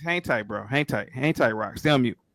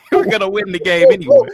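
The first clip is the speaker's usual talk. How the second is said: laughing through the words, much higher and louder; 9 dB louder than usual. Real, not acted. To the gematria callers, laughing at their theory. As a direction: laugh it off as you answer.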